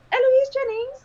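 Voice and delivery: high, loud dramatic name; announced character. Acted. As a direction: announce your name like an entrance. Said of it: "Looks like the entire place".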